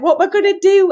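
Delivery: high-pitched mock wail of helplessness; retold tearful panic. Mum recalling her first childcare drop-off, tearful panic. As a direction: re-enact being overwhelmed, pitch leaping up. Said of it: "I burst into tears".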